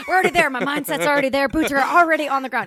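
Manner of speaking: loud, high, eager report; hammed-up battle readiness; mock gung-ho. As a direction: play the over-keen soldier, laughing through it. Joining the over-eager soldier bit.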